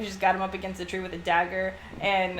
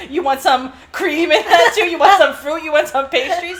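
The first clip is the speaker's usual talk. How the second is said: higher, louder sarcastic offers, laughing; butthurt sarcasm; 5 semitones higher, 8 dB louder than usual. Retold or imitated. Sulking prince mocks his guest with offers, sarcastic and laughing. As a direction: pile on the offers like a sulking host. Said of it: "he's so fucking butthurt".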